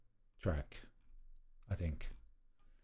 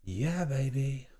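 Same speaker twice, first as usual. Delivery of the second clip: put-on voice; 4 semitones higher, 4 dB quieter, much slower than usual. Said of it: "that just sounded like Gordon Ramsay".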